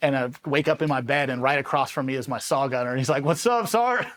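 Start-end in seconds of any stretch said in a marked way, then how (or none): 3.2-4.1 much higher-pitched mimicked hail; imitated cheery greeting. Saw gunner greeting his sergeant from the next bed, high-pitched imitation. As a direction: mimic your buddy's high, chirpy hello.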